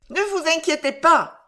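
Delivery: falsely reassuring, with an odd smile; smiling old aunt. A narrator's reading. Old aunt reassures the visitor, smiling high voice. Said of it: "dit-elle avec un sourire".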